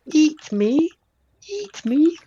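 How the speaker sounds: tiny, very high, loud stage-whisper squeal; whispering flower voice. Acted. Game master voices a flower tempting a player, high whispery squeak. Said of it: "orange flower that whispers".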